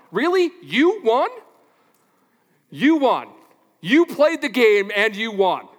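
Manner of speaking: loud, pressing disbelief; incredulous challenge. Real, not acted. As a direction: call out the empty boast. Mocking a fan's claimed win.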